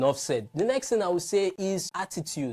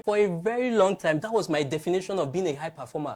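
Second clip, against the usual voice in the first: ordinary talk, nothing marked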